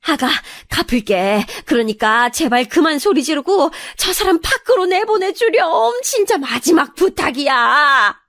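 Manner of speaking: distressed begging, rising high; anguished mother-in-law imploring; pleading mother-in-law. Acted. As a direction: beg desperately, stretching the final plea. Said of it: "시어머니가 빌다시피 말하니까".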